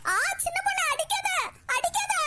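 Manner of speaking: high cry of pain, begging not to be hit; pained plea. Acted. Woman being beaten cries out to stop, high and pained. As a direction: yelp and beg him to stop.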